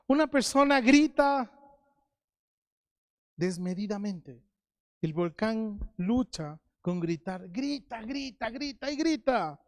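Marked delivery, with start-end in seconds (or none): none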